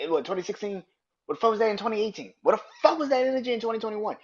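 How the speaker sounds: high, loud, fast hammering questions; furious challenge. Real, not acted. Vlogger grilling [name] on his timing, high and furious. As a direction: pound each year at him.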